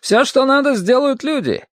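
high-pitched, emphatic rebuttal; heated objection. A narrator's reading.

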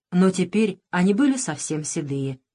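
ordinary delivery

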